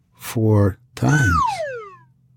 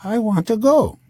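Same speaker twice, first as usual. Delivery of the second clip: much higher mock wife voice; imitating the wife; 12 semitones higher than usual. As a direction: insist like a stubborn wife.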